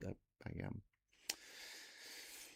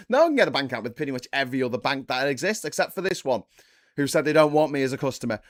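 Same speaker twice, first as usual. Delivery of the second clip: raised pitch, loud mock grievance; indignant complainer; 4 semitones higher, 6 dB louder, faster than usual. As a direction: complain loudly like a snubbed client. Farage complaining about refused account.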